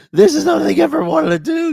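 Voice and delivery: high, loud wail at mom; mock-tearful shouted protest; hysterical outburst.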